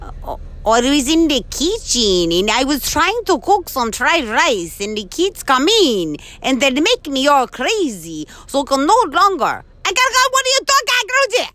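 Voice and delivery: loud, high mock Chinese accent. Shown as a demonstration. Partner ranting about kids in the kitchen, mock Chinese accent. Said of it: "You do a good Chinese".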